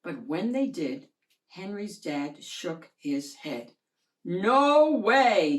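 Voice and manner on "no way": loud flat no from dad; firm refusal. Acted. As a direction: shut it down hard like a stern father. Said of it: "he said".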